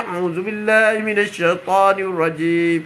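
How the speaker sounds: slow, felt recitation; recitation with meaning. Shown as a demonstration. Preacher showing reading with understanding, slow and felt. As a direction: recite it while feeling the plea behind it. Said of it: "Apabila kita baca".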